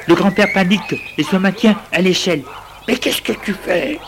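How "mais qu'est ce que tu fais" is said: alarmed high cry from the ladder; startled, voice jumping up in alarm; frightened old man calling out; panicked grandfather. A narrator's reading.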